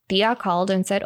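normal talking voice

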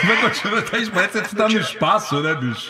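laughing through words. Real, not acted. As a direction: chuckle while talking.

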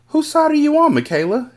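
high-pitched, accusing demand; challenging question. A narrator's reading. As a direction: confront a friend, voice shooting up. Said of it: "Annalise asked".

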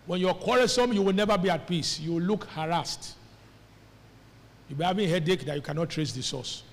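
ordinary delivery

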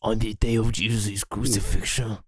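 lightly voiced Italian godfather accent. Godfather joke in a light Italian accent. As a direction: mob boss Italian accent, low. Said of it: "doing an Italian accent for fun".

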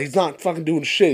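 ordinary talk, nothing marked

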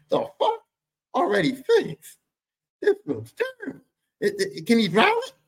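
very high, incredulous yelp; shocked disbelief. Retold or imitated. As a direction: squeal in total disbelief. Shocked at wrecked car.

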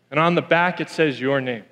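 normal talking voice

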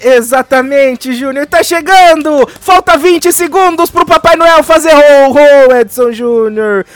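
high, loud shouted countdown numbers. Real, not acted. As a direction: shout every number like the last seconds of the year.

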